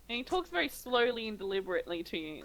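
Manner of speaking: ordinary talk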